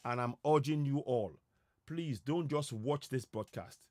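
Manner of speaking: normal talking voice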